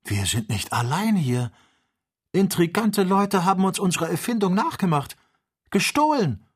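high-pitched indignant exclamation; worked-up, alarmed calling out; agitated outcry. A narrator's reading. Joe exclaims at seeing another balloon.

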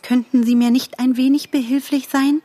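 high, lilting polite request; composed, almost cheerful. A narrator's reading. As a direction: ask sweetly as if nothing were wrong. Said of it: "gelassen und fast heiter". (gelassen und fast heiter)

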